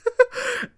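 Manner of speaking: a real burst of high-pitched laughter; cracking up at the story. Real, not acted. Vlogger laughing at the wrestlers' reaction, high burst.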